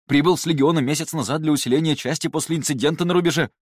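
loud, high rattled-off report; too much caffeine. A narrator's reading. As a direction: rattle it off without a pause. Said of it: "затараторил он".

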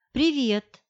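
light, carefree hello with a slight smile; беспечное приветствие. A narrator's reading. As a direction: toss off an easy, casual greeting. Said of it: "улыбнулась я беспечно". (беспечно, с лёгкой улыбкой)

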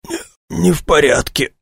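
high, strained croak; hoarse croak. A narrator's reading. Injured man answers that he is not all right, croaking hoarsely. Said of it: "прохрипел он".

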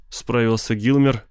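plain reading voice

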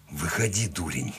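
quiet, slightly hoarse mutter to oneself; low-volume rasp, dropping off at the end; hoarse self-urging. A narrator's reading. Freezing man urging himself out of the water, quiet hoarse mutter. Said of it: "прохрипел я самому себе".

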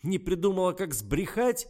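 very high, very loud screech; shrieking. A narrator's reading. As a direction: shriek the taunt at her. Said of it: "завизжал Ребров".

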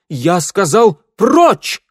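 high, loud roaring command; booming warrior king. A narrator's reading. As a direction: thunder the order. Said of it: "Голос короля грянул так". (громовой приказ короля)

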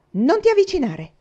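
threatening shout. A narrator's reading. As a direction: scream at him to keep back. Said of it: "lo minacciò Faith".

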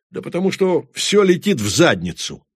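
громкий злой выкрик, выше и громче; взорваться криком от досады; взрыв ярости. A narrator's reading. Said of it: "взорвался Моран". (гневный выкрик Морана)

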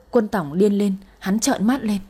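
ordinary delivery, nothing marked

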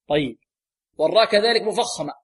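ordinary talk

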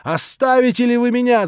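loud, high, outraged cry; furious shout. A narrator's reading. Natasha screaming at the prince to leave her. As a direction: yell him out of the room. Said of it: "закричала ещё раз Наташа".

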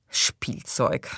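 low, voiceless scornful repeat; scornful echo. Acted. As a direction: throw the word back with mild contempt. (verächtlich wiederholt)

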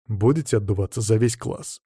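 ordinary delivery

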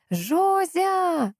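high, long holler of a name; trumpeting yell. A narrator's reading. Visitor hollers through grandmother's door, drawn-out high yell. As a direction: bellow it like a trumpet of Jericho. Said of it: "затем заорала, как иерихонская труба".

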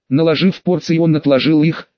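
usual speaking voice

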